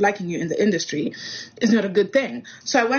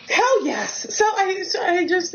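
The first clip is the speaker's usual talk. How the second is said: thrilled; 9 semitones higher, slower than usual. Real, not acted. Thrilled at the holiday invite.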